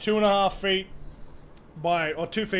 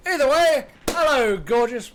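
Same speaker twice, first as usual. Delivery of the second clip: showy greeting; 7 semitones higher, 7 dB louder than usual. Real, not acted. Streamer welcoming a gorgeous viewer, showy greeting. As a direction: burst out with a big hello.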